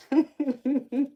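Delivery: high, loud giggle; real burst of high laughter; amused laugh. Real, not acted. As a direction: giggle brightly at being teased. Laughing at family teasing.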